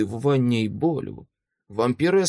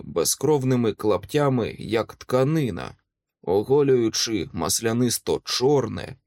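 ordinary delivery

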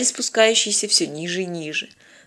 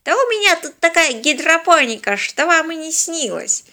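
put-on high-pitched, crotchety old man's brag; boastful old man; 9 semitones higher than usual. A narrator's reading. Old man bragging about his hydroponics, scornful boast.

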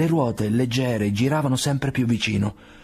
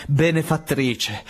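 drawn out, higher, awed cry; fervent exclamation; 5 semitones higher, much slower than usual. A narrator's reading. Grateful father to the lady who saved his son: slow awed exclamation. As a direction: call her his benefactress with slow fervour. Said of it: "esclamò Vasily Ivanovich".

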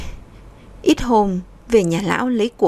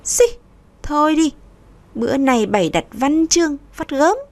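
higher, scoffing and dismissive; scornful scoff; 6 semitones higher than usual. A narrator's reading. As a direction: sneer it off, lip curled. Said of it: "Môi trên cô hách lên". (giọng cao, khinh khỉnh chế giễu)

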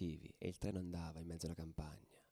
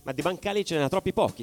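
louder and higher, urgent as the charge starts; heated retelling; 6 semitones higher, 14 dB louder than usual. Acted. Telling mates about the shield charge, loud and urgent. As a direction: drive the story forward like you're back on the tracks. Charge with pallets told loudly.

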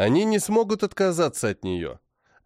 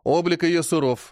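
plain reading voice

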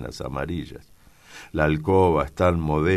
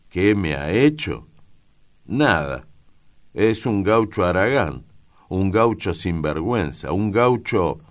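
louder, higher, forced indignation; strained vehemence; 4 semitones higher, 6 dB louder than usual. A narrator's reading. Father straining to justify himself to his son, indignant. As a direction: force out the insults, lacking real arguments. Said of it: "don Pancho habla con esfuerzo".

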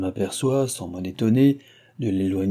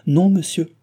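a brisk, higher, louder "Non"; quick sharp retort; 8 semitones higher, 7 dB louder than usual. A narrator's reading. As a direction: snap the no back at him at once. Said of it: "repartit-elle vivement".

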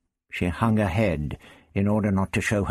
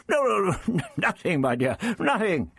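put-on high, fussy old man brushing it off; flustered denial; 14 semitones higher than usual. The Doctor brushes off Barbara's question, flustered. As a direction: dismiss it hastily in a high, embarrassed flutter.

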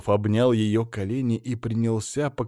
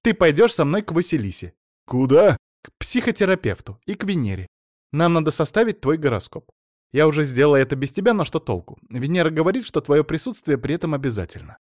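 higher-pitched, insistent wife; decisive; 6 semitones higher, 4 dB louder than usual. A narrator's reading. Wife ordering husband to the astrologer, decisive. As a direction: lay down the plan firmly, pitched up. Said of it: "Потом решительно сказала".

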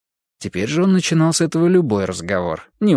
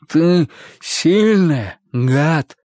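slow monster voice; 4 semitones higher, 3 dB louder, much slower than usual. Acted. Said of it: "сказал он".

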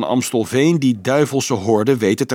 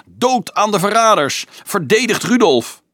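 high, furious battle cry; fierce shout; 9 semitones higher, 4 dB louder than usual. A narrator's reading. Leonardo swinging his club at the traitors, fierce shouting. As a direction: yell it like a rallying cry. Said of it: "schreeuwde met bliksemende ogen".

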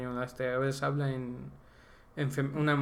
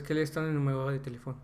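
fast French phrase in one breath; quick run-through; faster than usual. Shown as a demonstration. Showing how the French phone question is pronounced, fast and fluent. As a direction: rattle off the French question without stopping. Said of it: "Pero pronunciar es".

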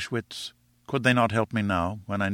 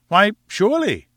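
high, emphatic agreement; eager cry; 11 semitones higher, 6 dB louder than usual. A narrator's reading. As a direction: agree brightly with too much confidence. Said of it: "I cried".

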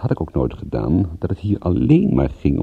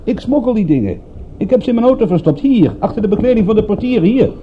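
mock confession pitched much higher, played as a gag; cheeky joke confession to the inspector; joking fake confession; 14 semitones higher, 4 dB louder than usual. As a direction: toss off the smuggling confession like a prank. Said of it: "[name] staat bekend als een lollige broek".

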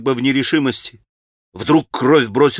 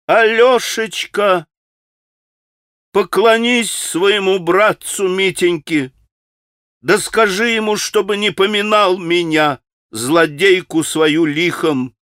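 ringing call; 6 semitones higher than usual. Acted. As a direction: call after him from the window. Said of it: "звонким голосом прокричала вслед Алёше". (звонкий окрик)

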